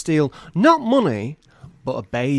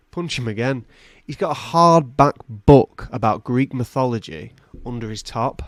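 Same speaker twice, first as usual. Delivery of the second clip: ordinary talk, nothing marked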